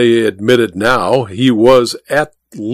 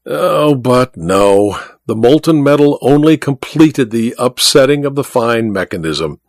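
plain reading voice

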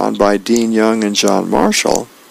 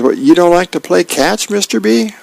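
put-on high-pitched kid's incredulous question; child's pleading question, climbing high at the end; questioning little kid; 8 semitones higher than usual. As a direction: pitch it up like a puzzled little boy. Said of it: "Elmo says".

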